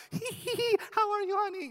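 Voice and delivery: very high, giggly caricature of a doting wife; tee-hee in a squeaky falsetto, then coo the greeting; mock submissive wife. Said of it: "I wanted a wife who'd be like".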